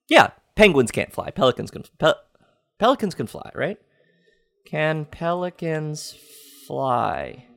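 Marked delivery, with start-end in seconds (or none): 4.7-7.5 slow, soft, deliberate query to a phone assistant; voice command. Real, not acted. Asking Google on his phone, slow and soft. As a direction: spell it out slowly for the machine.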